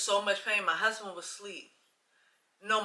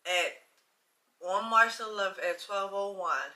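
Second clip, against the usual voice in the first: normal talking voice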